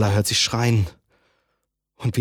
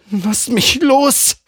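hoch, langsam und laut gerufen; ruft; 13 semitones higher, 7 dB louder, much slower than usual. Acted. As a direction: ruf verzweifelt um Freiheit. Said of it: "ruft sie". (laut und gedehnt rufen)

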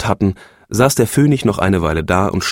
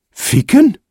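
„Ficken?“ hoch und steigend, ungläubig; empörte Nachfrage; 4 dB louder than usual. A narrator's reading. (die Phönigin fragt empört zurück)

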